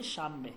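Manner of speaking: শাম বে with an m sound, not শান; শাম বে with a clear m before বে; correct pronunciation. Shown as a demonstration. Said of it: "বলবো কি?".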